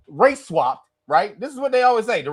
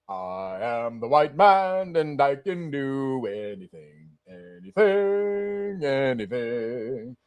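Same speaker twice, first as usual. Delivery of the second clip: much lower, slow, grandiose voice; 9 semitones lower, 3 dB quieter, much slower than usual. Pretend. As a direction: boom it out like a self-important savior.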